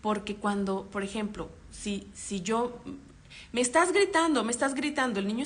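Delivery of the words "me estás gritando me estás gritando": mock yell. Shown as a demonstration. Parent modelling a yelled complaint.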